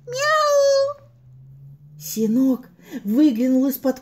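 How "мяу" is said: cat meow. Acted. Mysterious meow above ear.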